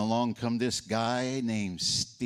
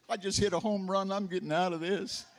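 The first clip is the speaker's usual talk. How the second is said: put-on smug kid triumph; 10 semitones higher than usual. Boy's inner triumphant thought.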